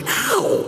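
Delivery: high-pitched "ow"; quick, squeaky retold cry of pain; mock yelp of pain. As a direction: imitate a kid getting smacked. Acting out being hit by grandmother.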